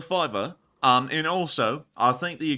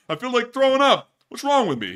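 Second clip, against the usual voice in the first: hammed-up stand-up comic; 10 semitones higher, 8 dB louder than usual. Streamer doing a comic's routine between super chats. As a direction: belt out one-liners like a nightclub comic.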